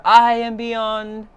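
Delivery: plain reading voice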